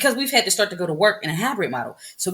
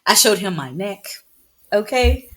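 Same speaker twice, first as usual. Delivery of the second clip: normal talking voice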